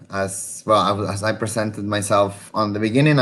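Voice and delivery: ordinary talk, nothing marked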